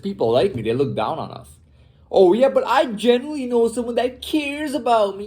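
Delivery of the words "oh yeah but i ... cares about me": high, whining mockery of a weakling; mocking whiny voice. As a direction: sneer it in a high, pathetic put-on voice. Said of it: "I hate when people talk like that".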